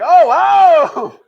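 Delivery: high, loud amazed oh wow; sudden delighted wow; delighted surprise. Retold or imitated. Delighted realization.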